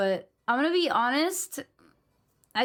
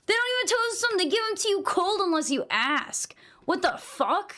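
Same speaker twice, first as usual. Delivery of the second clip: indignant rant; 8 semitones higher than usual. Real, not acted. Ranting about cold untoasted bagels.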